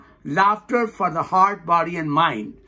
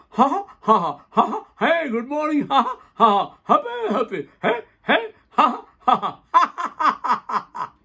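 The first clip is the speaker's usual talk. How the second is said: bursts of forced laughing calls; jolly ha-has and heys, jumping in pitch; demonstrated driving laughter; 4 semitones higher than usual. Shown as a demonstration. Teacher laughing through a traffic jam demo, jolly forced laughter.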